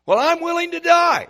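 high, loud, boastful Peter; Peter's bold vow. Retold or imitated. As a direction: belt it out like a brash disciple swearing loyalty.

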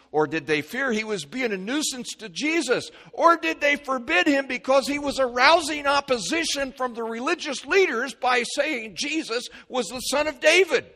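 high, loud building questions; rising preaching. Real, not acted.

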